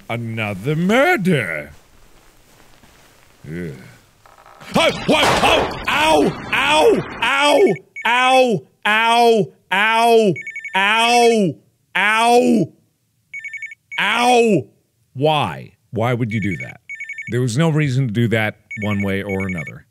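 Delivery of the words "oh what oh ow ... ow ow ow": high, repeated ow yelps; mock pain yelps. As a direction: yelp ow over and over. Character hurt, repeated ow.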